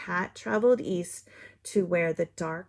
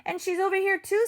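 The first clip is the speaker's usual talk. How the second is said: higher and quicker, rising; climbing punchline take; higher, faster; 11 semitones higher, 4 dB louder, faster than usual. Acted. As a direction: pitch it up and hurry. Counting broken pieces.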